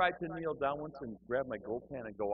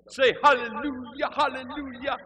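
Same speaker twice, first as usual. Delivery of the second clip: high, loud, drawn-out revival shout; revved-up minister; 10 dB louder than usual. Retold or imitated. Minister comes out calling to the church, really revved up. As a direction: imitate a preacher firing up the congregation. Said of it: "he was really revved up".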